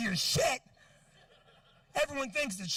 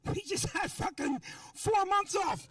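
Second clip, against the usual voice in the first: mock outrage, pitched way up; furious rant; 9 semitones higher than usual. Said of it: "I'm like".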